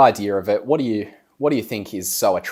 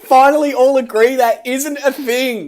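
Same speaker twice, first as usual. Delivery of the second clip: mock scolding; 12 semitones higher, 5 dB louder than usual. Mock scolding the manifestation idea.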